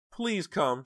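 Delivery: high, loud plea; desperate begging. A narrator's reading. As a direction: beg someone to come, panicked. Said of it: "she begged again".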